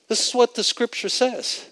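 ordinary talk

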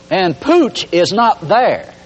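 normal talking voice

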